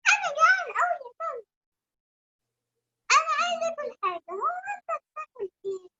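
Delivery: put-on high, fast, whiny child voice; little bunny voice. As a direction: squeak it like a hungry bunny. Bunny asks dog.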